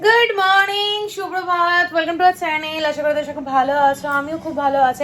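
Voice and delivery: upbeat channel-host opener; vlog intro. Acted. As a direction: greet the viewers like a channel host.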